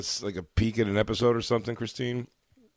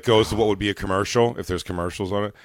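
ordinary talk

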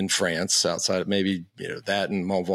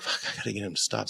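muttered thought; 8 dB quieter, faster than usual. Retold or imitated. Inner thought mid-race.

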